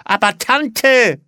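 high, drawn-out protesting yell; Schrei. A narrator's reading. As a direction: shout in protest, stretching it out. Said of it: "schrie Olli". (laut protestierend geschrien)

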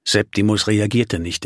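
plain reading voice

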